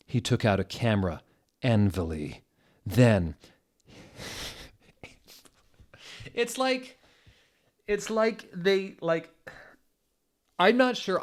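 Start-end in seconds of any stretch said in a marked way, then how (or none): 4.9-11.2 high, broken by laughter; can't get through it for laughing; real giggle fit. Real, not acted. Reader cracks up.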